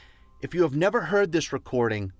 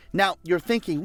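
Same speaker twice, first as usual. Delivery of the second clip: normal talking voice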